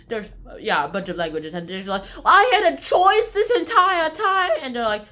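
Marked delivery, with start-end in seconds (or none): 2.2-4.5 high, loud, stretched exclamation; outraged realisation. Retold or imitated. Ada discovers other religions exist, outraged realisation. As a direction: wail it out slowly in mock outrage.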